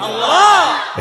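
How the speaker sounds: high call with falling end; shouted response. Real, not acted. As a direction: cry out the answer high. Shouted answer to his own question.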